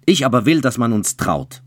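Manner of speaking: loud, high domineering retort; angry insistence. A narrator's reading. Childeret overrules the bride before the priest, loud and angry. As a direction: slam his will down on her.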